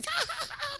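loud, very high mock cackle; imitated laughing fit. Imitating Ryan unable to stop laughing, loud high cackle. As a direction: burst into someone else's uncontrollable laugh.

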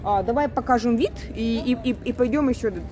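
usual speaking voice

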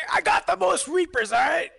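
high, insistent yell; shouted boast. Real, not acted. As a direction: proclaim it loudly, pitch way up. Loud boast answering a whispered one.

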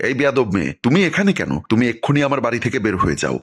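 plain reading voice

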